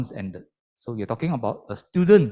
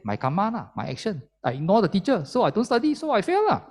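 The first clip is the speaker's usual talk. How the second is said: mock careless student, pitch climbing much higher by the end; lazy student's excuse; 8 semitones higher than usual. Teacher mimics a lazy student blaming karma, rising higher. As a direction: shrug it off like a student who skipped class. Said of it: "They, they can say".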